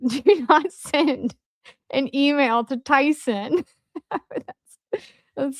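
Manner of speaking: real laughter breaking into the words; laughing. Real, not acted. Cracking up at viewer's suggestion.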